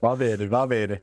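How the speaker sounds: put-on drawn-out playful Italian accent. Joining his friend's Italian story, in a slow mock Italian accent. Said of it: "You remember the accent, eh?".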